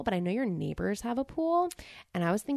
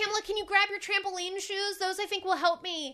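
desperate plea; 10 semitones higher than usual. Pretend. Drowning plea.

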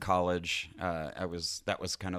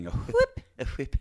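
squeaky laughing echo; 4 dB louder, faster than usual. Real, not acted.

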